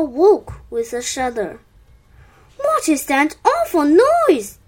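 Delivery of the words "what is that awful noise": higher-pitched, puzzled question; wondering. A narrator's reading. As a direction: wonder aloud, a touch startled. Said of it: "he wondered".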